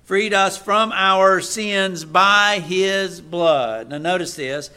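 loud, slow scripture proclamation with fervent asides; preaching at full force. Acted. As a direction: proclaim each phrase to the church. Reading Revelation with fervent asides.

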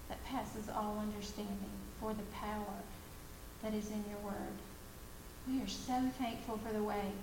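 soft, hushed prayer voice; closing prayer. Real, not acted. Speaker leading the women in a soft, reverent prayer. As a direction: pray quietly and reverently.

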